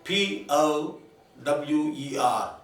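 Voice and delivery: slow letter-by-letter spelling. Shown as a demonstration. Teacher spelling the word for the class. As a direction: spell P O W E R slowly. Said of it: "For example".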